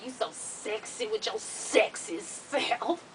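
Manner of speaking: slow, soft, low imitation; flirting man. He sweet-talks the triage lady, slow hushed imitation. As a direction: draw it out softly like his come-on.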